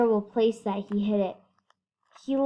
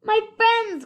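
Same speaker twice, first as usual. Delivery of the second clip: high, loud cry of joy; cried; 11 semitones higher, 7 dB louder than usual. A narrator's reading. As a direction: cry out to the tiny friends. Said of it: "cried the elephant".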